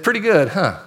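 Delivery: "Pretty good" echoed back, dry and doubting; skeptical echo. Retold or imitated. Leader echoes the lukewarm answer.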